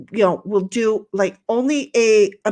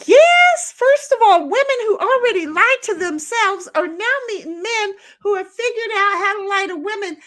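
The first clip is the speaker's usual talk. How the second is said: worked-up rant; 9 semitones higher than usual. Real, not acted. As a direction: rant it at the top of your voice, fed up. Ranting at internet dating chat.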